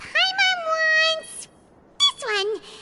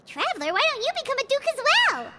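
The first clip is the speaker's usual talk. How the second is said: ordinary talk, nothing marked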